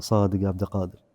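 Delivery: a touch of cunning, said softly; sly. Acted. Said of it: "طالع فيه بخبث وقال".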